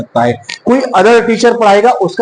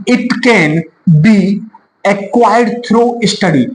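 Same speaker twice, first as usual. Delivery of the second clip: slow reading aloud; much slower than usual. Shown as a demonstration. Reads definition slowly.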